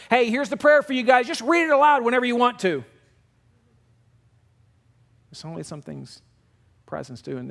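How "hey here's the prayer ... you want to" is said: high, loud, offhand delivery of an imagined text; mock breezy message. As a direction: toss it out with exaggerated casualness. Imagined flippant text-message prayer.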